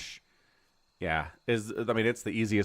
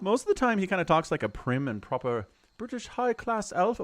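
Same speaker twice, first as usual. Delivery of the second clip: usual speaking voice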